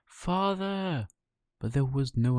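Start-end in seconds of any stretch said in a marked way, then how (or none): 0.0-1.1 higher, longer call across the water; high, drawn-out searching call. Acted. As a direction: call again, more desperate. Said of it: "he called out".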